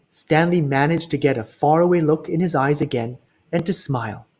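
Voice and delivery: plain reading voice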